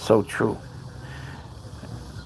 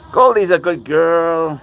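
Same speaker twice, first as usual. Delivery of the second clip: pet praise; 6 semitones higher, 5 dB louder, slower than usual. Real, not acted. Praising cat in pet talk.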